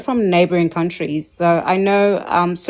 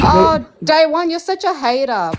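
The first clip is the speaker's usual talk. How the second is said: teasing whine; 10 semitones higher than usual. Real, not acted. Teasing protest at hater.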